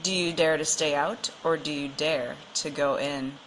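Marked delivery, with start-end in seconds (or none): none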